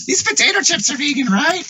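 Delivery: high-pitched mock question from a clueless vegan. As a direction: play a naive vegan checking the chips.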